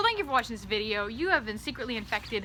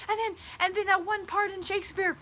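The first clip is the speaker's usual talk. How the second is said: high fangirl voice; 10 semitones higher than usual. Retold or imitated. Fangirl voice continues.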